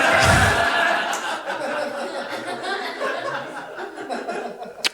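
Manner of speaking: long airy laugh after the punchline; breathy laugh. Real, not acted. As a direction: let a laugh spill out.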